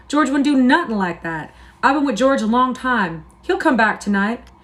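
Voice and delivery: high, loud, anxious insistence; a big simple man clinging to hope; upset Lenny. A narrator's reading. Lenny defending George, upset.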